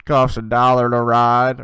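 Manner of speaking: loud, slow bus driver line; a hint of a blunt bus driver, slow and loud; mock bus driver. Bus driver to a would-be passenger, loudly mocked. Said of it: "they look at you and they go".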